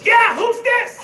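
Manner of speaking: high, loud, wary answer; suspicious dad. Retold or imitated. Dad on the phone, loudly asking who it is. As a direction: demand who's calling. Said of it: "My dad goes".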